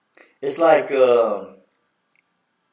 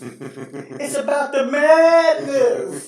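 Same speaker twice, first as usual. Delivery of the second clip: high, slow, shouted madness; Vitale impression; 12 semitones higher, much slower than usual. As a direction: hype it like a broadcaster. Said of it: "He was like saying".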